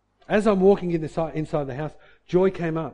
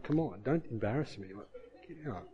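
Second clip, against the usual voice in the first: much softer, under his breath to the key; muttered self-talk; 6 semitones lower, 11 dB quieter than usual. Retold or imitated. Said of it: "And I'm getting angry".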